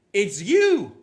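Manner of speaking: loud sudden cry of recognition; shouted accusation. Retold or imitated. Woman pointing at preacher shouting. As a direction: call out across a busy market.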